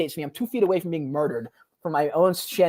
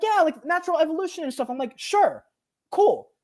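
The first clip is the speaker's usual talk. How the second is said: mocking skeptic; 9 semitones higher than usual. Mocking skeptics and his reply.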